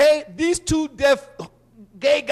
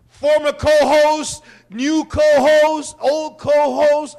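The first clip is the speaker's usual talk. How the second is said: loud call-out; 4 semitones higher, 8 dB louder, slower than usual. Real, not acted. Calling out his co-hosts.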